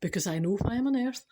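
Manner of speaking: usual speaking voice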